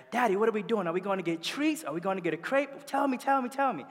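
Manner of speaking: put-on high, eager little-girl voice; high child's voice pestering daddy for a treat; little girl begging. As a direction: beg like an excited child.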